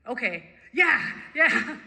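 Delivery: emphatic, escalating assent; eager agreement. Retold or imitated. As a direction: burst out in agreement after holding back. Eager answer to the inner voice.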